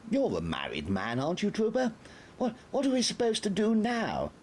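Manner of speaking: put-on helpless old officer asking for rescue; flustered Major. A narrator's reading. The Major begs Trooper Gabatas for help, flustered.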